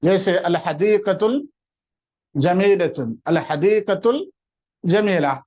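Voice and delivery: الحدیقۃ الجمیلۃ spelled out slowly in Arabic; slow careful Arabic reading; slow Arabic phrase. Shown as a demonstration. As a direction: say الحدیقۃ الجمیلہ slowly, each sound clear. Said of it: "Arabic phrase demo slowly".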